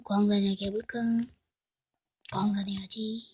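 unvoiced muttered aside; hushed self-talk; whisper. Real, not acted. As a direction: whisper to yourself.

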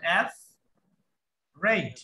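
অ্যাফ রেড said as two separate parts with a pause; syllable by syllable. Shown as a demonstration. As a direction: break অ্যাফ from রেড clearly. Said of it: "অ্যাফ একটা অংশ, রেড আর একটা অংশ".

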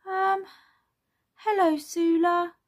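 put-on high little-kid voice; hesitant child character. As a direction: greet hesitantly as a small child. Voices Bing's unsure reply.

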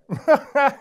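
high, loud scornful laugh; mocking hare laugh. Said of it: "lachte der Hase spöttisch".

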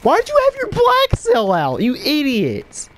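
very high, loud furious yell; high-pitched gamer meltdown; shrieking rage. Real, not acted. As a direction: scream at the teammate, slowing on idiot. Raging at a teammate's mistake.

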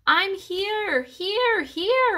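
very high squeaky calls; loud hamster squeak. A narrator's reading. Lost hamster answers his searchers, loud squeaking. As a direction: squeak it out as big as a tiny animal can. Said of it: "I squeaked as loudly as a hamster can".

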